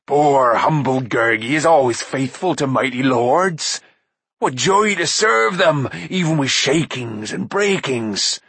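slow, whiny, groveling beast voice; fawning creature. A narrator's reading. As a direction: grovel to the mighty lords. Gurgi's creature voice.

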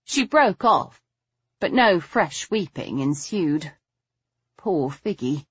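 usual speaking voice

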